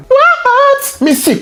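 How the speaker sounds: very high squeaky What; [name] squeak. Acted. Fake [name] squeaks in surprise at Cathy.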